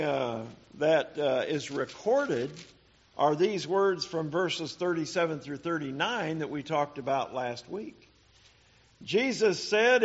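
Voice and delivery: normal talking voice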